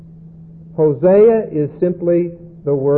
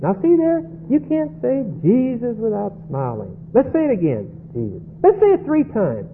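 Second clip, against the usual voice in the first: much higher and soft, a sing-song parody of a sweet leader; lightly hammed-up cheerful worship leader coaxing the room; overly sweet leader voice, gently mocked; mock cheery group leader; 6 semitones higher, 4 dB quieter than usual. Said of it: "You're in a group, see?".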